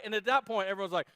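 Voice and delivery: ordinary talk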